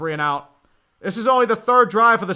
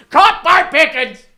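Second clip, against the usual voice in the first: loud, high, drawn-out announcer yell; excited call; 7 semitones higher, 11 dB louder, slower than usual. Acted. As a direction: explode on the big catch. Big catch in play-by-play.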